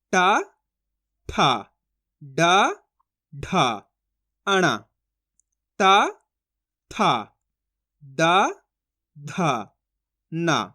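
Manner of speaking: "टा, ठा, डा, ढा, अणा, ता, था, दा, धा, ना" one by one, long pauses; letter drill. Shown as a demonstration. Teacher repeating letters for learners, spaced sing-song drill from "टा" to "ना". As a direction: recite "टा, ठा, डा, ढा" onward, alternately rising and falling. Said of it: "इनको repeat कर लेते हैं अपन".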